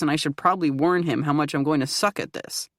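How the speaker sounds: ordinary delivery, nothing marked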